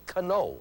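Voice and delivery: KANO with a K sound before the N. Shown as a demonstration. Teacher showing a wrong reading, KANO with sounded K. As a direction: say KANO with the silent K voiced as its own syllable. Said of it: "That K does not make a sound here. You do not say".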